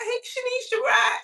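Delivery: high, bright-pitched excited greeting; excited call. Retold or imitated. Picking up her friend's late call, excitedly calling out. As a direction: call her name up high, half crying out.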